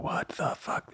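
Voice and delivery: breathless whisper; whispered aside. Retold or imitated. Voicing Leah's silent reaction to the chaos, in a whisper. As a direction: mouth it under your breath. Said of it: "Her face just says it all".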